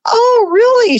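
high-pitched show of polite surprise; feigned surprise. Retold or imitated. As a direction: react as if this is news to you. Feigned interest in woman's story, retold.

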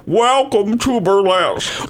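high, slow showgirl drawl; campy diva voice. Pretend. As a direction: purr it like a burlesque star. Campy movie line.

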